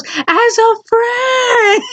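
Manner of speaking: high squeaky flustered denial; panicked backpedal. Retold or imitated. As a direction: plead your innocence in a cracking voice. Guy backpedaling, caught out.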